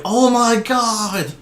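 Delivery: high, slow awestruck gasp; crowd awe. Acted. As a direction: gush like a starstruck fan. Crowd gasps at champion.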